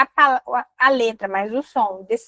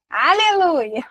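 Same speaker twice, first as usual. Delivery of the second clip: playful "Aleluia!"; a joking cheer of relief; relieved hallelujah; 5 semitones higher, much slower than usual. Real, not acted. Relief, last item.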